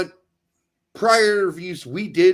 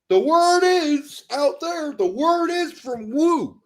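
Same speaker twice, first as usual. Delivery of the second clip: higher, slow sneering parody voice; mocking parody; 9 semitones higher, slower than usual. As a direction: drag it out to mock him. Mocking the pundit's vague phrase.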